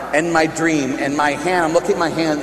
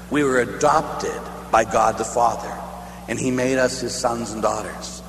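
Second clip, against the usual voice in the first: ordinary delivery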